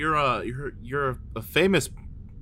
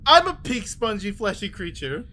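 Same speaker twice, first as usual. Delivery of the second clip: excited; 6 semitones higher, 3 dB louder than usual. Acted. Realizes he matches description.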